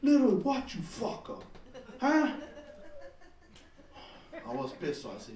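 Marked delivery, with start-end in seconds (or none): 0.0-2.3 a hint of Hawaiian local boy aggression; local boy pidgin. Stand-up retelling his raging local-boy outburst, a touch of Hawaiian pidgin challenge. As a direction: pick a fight, local style, lightly. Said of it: "the local boy came out of me".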